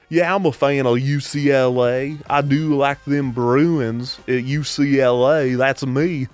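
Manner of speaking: broad, exaggerated Southern accent; mock Southern accent. Joking as an unlikely U C L A fan, mock Southern accent. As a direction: ham up a proud Southern fan. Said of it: "not even a Southern or a Texas accent".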